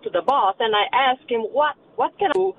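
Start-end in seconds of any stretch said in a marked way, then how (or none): none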